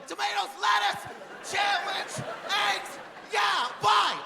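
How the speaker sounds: very high, strained list of words; crazy-sounding phone answers. Retold or imitated. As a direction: imitate yourself sounding like a lunatic on the bus. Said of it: "you know how crazy I look?".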